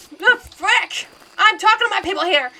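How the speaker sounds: sudden loud startled outburst; startled yell. Real, not acted. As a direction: shout in real shock. Startled by a ghost talking.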